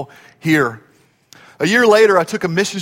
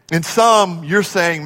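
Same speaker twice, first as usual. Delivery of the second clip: ordinary delivery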